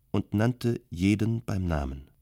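usual speaking voice

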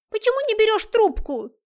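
furious high yell; angry shout. Acted. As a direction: scream the question at him. Nastya storms in like a fury.

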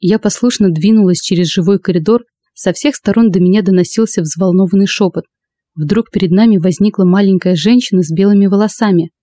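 ordinary delivery

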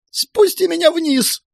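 very high, drawn-out squeal; piping, panicky woman's voice; squeaking. A narrator's reading. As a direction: squeak helplessly to be let down. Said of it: "пищала она".

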